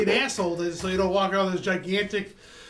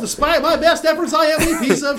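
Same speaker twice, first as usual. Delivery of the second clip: louder, laughing self-mocking shout; 5 semitones higher, 5 dB louder than usual. Real, not acted. Mock despair after a bad roll.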